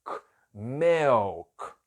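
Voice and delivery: "milk" ending on a hard K from the throat; "milk" com K na garganta. Shown as a demonstration. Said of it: "termino com K aqui na garganta".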